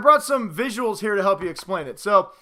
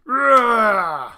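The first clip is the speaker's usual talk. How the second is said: a loud roaring Thanos growl; monster roar; 3 semitones higher, 6 dB louder than usual. Acted. Thanos doll hits the quarterback, hammed-up roar.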